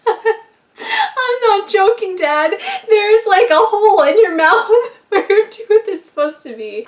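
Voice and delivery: high, loud protest through laughter; real cracking up while insisting; laughing hard. Real, not acted. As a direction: squeal the words out between laughs. Cracks up retelling her reply.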